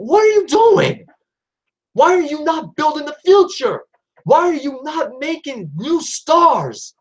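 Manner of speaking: high, exasperated yell, then slowing into disbelief; high, slow, incredulous yelling at the company; exasperated outburst. Real, not acted. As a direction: throw up your hands at the bookers, shouting. Frustrated rant about wasted talent.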